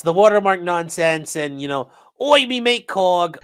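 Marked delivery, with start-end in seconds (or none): 2.2-3.4 put-on slow, higher British accent with oi; a mock Cockney greeting in a British accent. Mocking a rival in a British accent. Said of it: "I tried to do the British accent".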